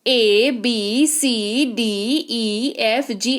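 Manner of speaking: letters spelled out one by one, slowly; slow spelling of the alphabet; reciting letters slowly. Shown as a demonstration. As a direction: call out each letter with a gap. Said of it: "कौन कौन से हैं".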